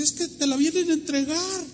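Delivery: high, urgent explaining; high-pitched insistent retelling; acted excitement. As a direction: imitate an excited woman breaking news. Excited woman explains, acted quote.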